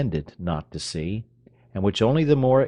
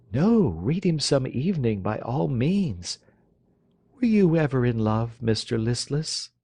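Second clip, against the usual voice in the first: high, arch teasing voice; teasing young lady; 6 semitones higher than usual. Acted. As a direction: tease the dandy. Marionetta teasing.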